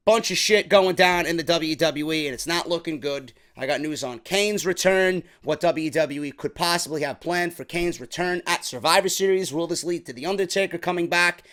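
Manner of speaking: ordinary delivery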